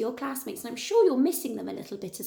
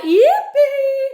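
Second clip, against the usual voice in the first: very high, loud whoop; jubilant cry; 13 semitones higher, 12 dB louder than usual. A narrator's reading. Tyrannosaurs delighted at the fallen tree, high jubilant shout. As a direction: squeal it out long and loud. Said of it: "cried out".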